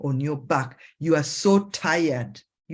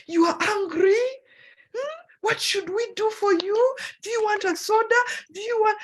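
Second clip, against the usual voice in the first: high, fussing voice, like a worried friend checking on you; worried friend fussing over a tired, angry [name]; fussing self-talk; 13 semitones higher than usual. Pretend. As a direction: fuss over yourself in a much higher, pleading voice. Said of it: "Look at yourself and you're like".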